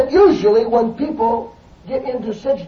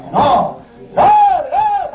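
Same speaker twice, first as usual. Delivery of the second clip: soaring preached repetition; 5 dB louder than usual. Real, not acted. Preacher urging the church to keep going on, soaring pitch. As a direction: voice climbing far higher on each on.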